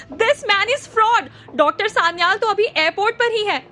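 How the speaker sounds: furious accusing cry; shouting. Acted. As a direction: yell it out to expose him. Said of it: "जोर से चिल्लाई".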